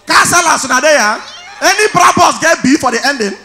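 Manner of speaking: usual speaking voice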